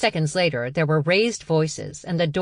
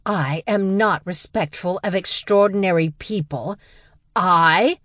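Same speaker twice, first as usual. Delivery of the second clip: builds to a loud high bellow on "I?"; outraged sister bellowing back; barked outrage; 4 semitones higher, 4 dB louder than usual. Acted. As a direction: blast the last word at her. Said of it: "she barked".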